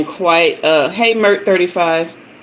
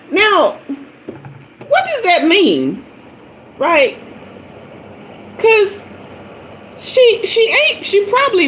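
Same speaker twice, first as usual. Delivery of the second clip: incredulous; 13 semitones higher than usual. Real, not acted. Incredulous about the lady.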